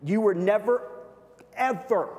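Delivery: "never" and "ever" pitched high and loud; stress on never ever. Acted. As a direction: lean hard on "never" and then on "ever". Preacher's emphatic point.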